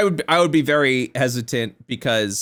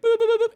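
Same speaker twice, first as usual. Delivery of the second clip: playful boops; much faster than usual. Retold or imitated. Imitating quick AI generation.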